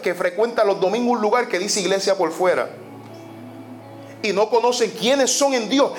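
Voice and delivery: usual speaking voice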